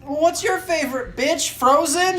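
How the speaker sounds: very high, loud mock question; mocking retort. Co-host answers for his friend to the girl, mocking.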